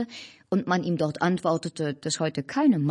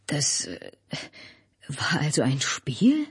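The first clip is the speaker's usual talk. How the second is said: subdued, hesitant question; crestfallen, slow murmur of disbelief; dejected; 2 semitones lower, slower than usual. A narrator's reading. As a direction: ask it downcast, halting and slow. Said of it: "fragte Albert niedergedrückt".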